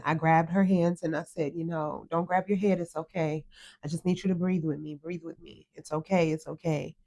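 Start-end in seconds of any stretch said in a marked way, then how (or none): 1.5-6.9 softer, calm voice for a frightened daughter; soothing reassurance. Retold or imitated. Mother calming her hurt daughter in the car, soft and soothing. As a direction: talk her down gently, like holding her hands.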